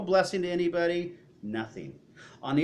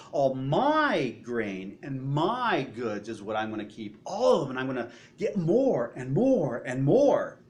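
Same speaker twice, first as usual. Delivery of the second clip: "my" hit hard each time, slow and louder; stress on the pronouns; 4 dB louder than usual. Shown as a demonstration. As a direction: lean on every "my" in "All my grain and my goods". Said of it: "Notice the emphasis on the pronouns".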